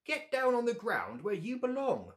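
high, loud squawk; squawking bird. Acted. Mother bird shoos Bear from the nest, loud squawk. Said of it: "squawked the bird".

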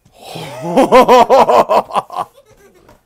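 big loud burst of laughter. Real, not acted. Drunk streamer stands up, laughs.

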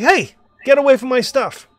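high, loud Australian holler; heavy Australian accent. Acted. Hillside hermit warning strangers off his tent, shouting in heavy Australian accent. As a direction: holler it from afar in broad Australian. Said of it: "very, very heavy Australian accent".

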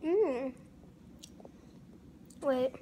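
speaking while chewing; snack stick between lips; mouth full. Real, not acted. Seen 2: Pocky stick held between lips while humming, then snapped off.

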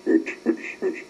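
ordinary delivery

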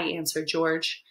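plain reading voice

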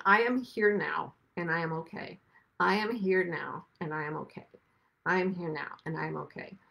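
meditation mantra. Shown as a demonstration. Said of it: "all meditation is, is saying".

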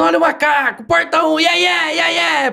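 high, chanting show-host cries; high-pitched mock yelling, no added volume; imitated TV catchphrase shouting. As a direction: call it out like a hyped catchphrase. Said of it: "ele tava gritando".

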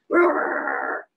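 low rumbling bear growl; a deep, menacing animal growl. Acted. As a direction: growl like a big angry bear. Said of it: "he growled".

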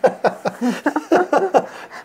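bursting into a genuine laugh; hearty amused laughter; real laugh. Real, not acted. Recalls client's funny remark.

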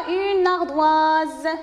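"une ardoise" drawn out slowly; slow model reading. Shown as a demonstration. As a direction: read "une ardoise" slowly for young pupils.

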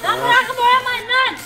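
angry yelling. Real, not acted. Friends spray-painting his car.

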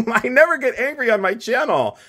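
high laughing self-mockery. Real, not acted. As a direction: giggle through the denial. Laughing at her own frustration.

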